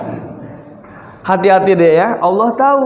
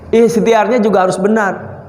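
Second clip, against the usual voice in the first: ordinary talk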